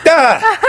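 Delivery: a high, loud laughing shriek of embarrassment; laughing mock yelp. Caught out in front of the staff, laughing high shriek. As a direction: squeal as everyone turns to look. Everyone looks at her wound.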